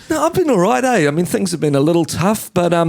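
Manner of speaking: high, put-on chattiness; mock-eager small talk; nervous fan chat.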